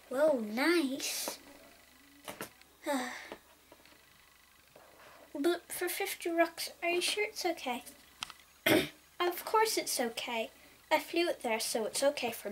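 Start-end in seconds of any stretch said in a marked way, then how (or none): none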